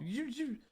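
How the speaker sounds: high soft zoo zoo; light whooshing toy sound; toy whoosh noise. Retold or imitated. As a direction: mimic the toy flying up. Mimicking toy sound effect.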